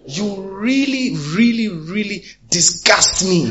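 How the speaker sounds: high, slow, contemptuous shouted quarrel; acted angry outburst. Preacher voicing a friend exploding in anger, disgusted. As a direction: spit out the disgust at an old friend.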